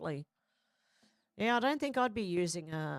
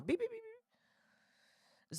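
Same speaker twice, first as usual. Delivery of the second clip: high, soft electronic beeps; drawn-out string of high little beeps; imitated beeps; 6 dB quieter, much slower than usual. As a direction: imitate a car remote's beeping, very high and quiet. Imitates car key buttons beeping.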